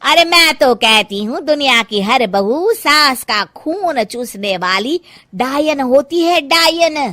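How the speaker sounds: loud, high theatrical rant, cracking into a laugh; ranting mother-in-law. Acted. Mamta railing against daughters-in-law to the women, loud and shrill. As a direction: spit out the word witch.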